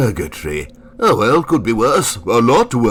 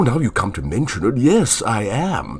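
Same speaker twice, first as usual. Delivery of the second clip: ordinary talk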